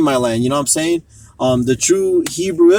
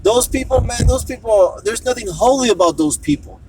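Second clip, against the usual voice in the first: quoting the woman; 7 semitones higher than usual. Retold or imitated. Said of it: "she's like".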